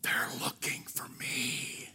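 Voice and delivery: put-on slow, secretive little boy whisper; child's whisper. Little boy whispering to the salesman on the phone. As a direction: breathe it like a kid hiding something. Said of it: "And the little voice said".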